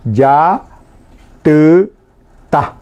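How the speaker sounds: "Ya, tu, ta" split into separate syllables with pauses. Shown as a demonstration. Teacher splitting the word into syllables for the class. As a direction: break "Ya, tu, ta" into its three syllables. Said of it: "cae con todas las vocales".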